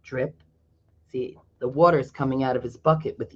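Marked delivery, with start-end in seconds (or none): 0.0-0.4 lower, much softer drip drip; soft dripping. Acted. Narrator marks drops leaving Felix's bucket, softly. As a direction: let each drip fall quietly.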